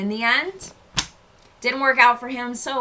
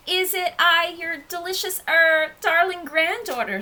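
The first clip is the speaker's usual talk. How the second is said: put-on wolf posing as a girl in high pitch; high disguised voice; 8 semitones higher, 3 dB louder than usual. A narrator's reading. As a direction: put on a high, fake granddaughter voice. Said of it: "said the wolf in the high voice".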